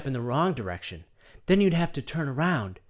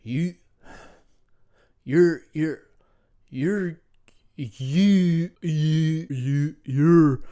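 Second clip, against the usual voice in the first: stumbling alpha voice; much slower than usual. Pretend. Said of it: "hasn't really done an alpha male voice".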